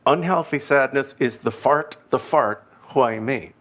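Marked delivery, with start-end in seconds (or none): none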